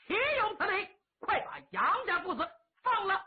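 angry prince berating the executioner; outraged command. Acted. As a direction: demand their release, voice up high. Prince orders prisoners freed.

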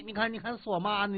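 ordinary delivery

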